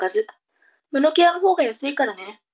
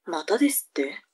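whisper; 6 semitones lower, 6 dB quieter than usual. Acted. Mother indignant, whispered.

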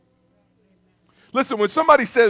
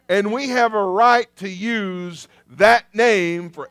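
slow, loud proclamation with a "hallelujah" tossed in; preaching at full force; 4 semitones higher, 6 dB louder, much slower than usual. Acted. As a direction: hammer the name home, drawing out each word.

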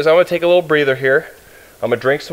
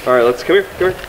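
usual speaking voice

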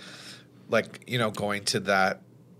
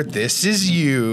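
slow mock explanation; 2 semitones higher, 4 dB louder, much slower than usual. Mock drawing it out for Miller.

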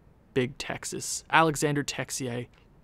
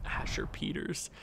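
whispered; 13 dB quieter than usual. Real, not acted. Repeating the funny name.

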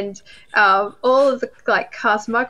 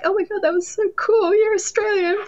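high-pitched gushing voice; gushing fans; 11 semitones higher than usual. Retold or imitated. People around her congratulating her, high excited gush. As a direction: imitate starstruck onlookers, pitched way up. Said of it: "everyone around me was like".

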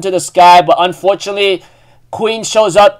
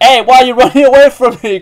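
higher, quick indignant call; mock calling out; 5 semitones higher, 4 dB louder, faster than usual. [name] to people running away, mock calling out. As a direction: call after people fleeing, playfully indignant.

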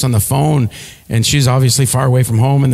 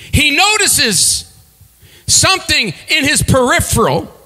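sermon storytelling; 8 semitones higher than usual. Acted. Preacher retelling Exodus to congregation, dramatic sermon voice. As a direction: paint the scene from the pulpit.

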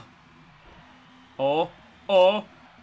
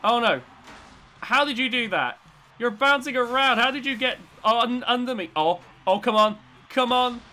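usual speaking voice

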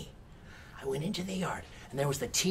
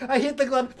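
ordinary delivery, nothing marked

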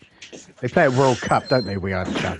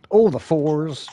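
louder, higher bingo call; bingo caller; 6 semitones higher, 6 dB louder, slower than usual. Pretend. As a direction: announce it across the hall. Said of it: "a shout out".

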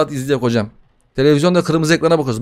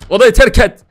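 higher, louder barked order; mock commanding shout; 7 semitones higher, 8 dB louder than usual. Gamer orders the demon baby out, mock shout. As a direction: snap the order like a boss.

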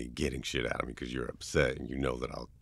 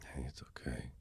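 soothing whisper; 12 dB quieter than usual. Acted. To a crying best friend, whispering comfort. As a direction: hush them gently while holding them.

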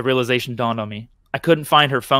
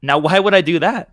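plain reading voice

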